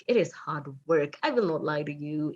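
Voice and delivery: usual speaking voice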